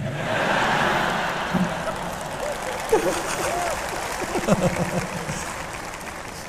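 high breathy laughter; long wheezy laugh. Real, not acted. Laughing at his story.

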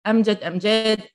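أمجد short then أمجاد with long aa; long aa contrast. Shown as a demonstration. Teacher contrasting أمجد with long vowel أمجاد. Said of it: "try to distinguish".

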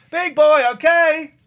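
high, sing-song voice for the cat; pet talk. Real, not acted. Talking sweetly to the cat.

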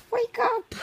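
very high shrill puppet; high puppet shriek. Pretend. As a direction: screech it like a puppet. Puppet yells at sleeper.

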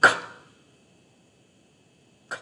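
"k" as a bare voiceless click of air; just the burst of "k"; isolated k sound. Shown as a demonstration. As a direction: release "k" with no vowel. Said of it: "Phát âm của nó là k".

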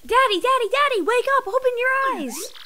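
a daughter shaking her father awake; frantic daughter. Acted. Daughter waking dying father.

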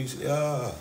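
normal talking voice

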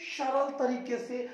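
ordinary delivery

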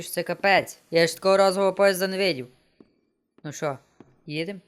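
ordinary talk

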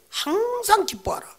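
high, slow, sing-song recitation; a solemn chant of scripture; high recited verse. Shown as a demonstration. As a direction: lift it up high and draw out each word. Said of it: "한 절이에요".

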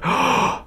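sharp high gasp; startled gasp. Real, not acted. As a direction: gasp in fright. Nearly falls off.

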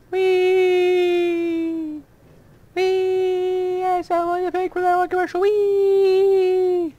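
high, loud squeals of joy; joyful whee. Real, not acted. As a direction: squeal like on a rollercoaster. Riding the minecart, spinning.

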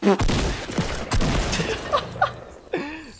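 high wheezy burst of laughter; breathy high laugh. Real, not acted. Gamer laughing after a kill, high wheezing laugh.

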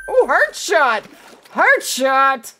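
loud, thrilled at the shot; excited exclamation. Real, not acted. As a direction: cheer the heart shot.

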